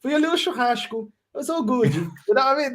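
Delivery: put-on high Portuguese-accented mum. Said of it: "The way you do that kills me".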